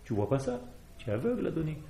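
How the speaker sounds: ordinary talk, nothing marked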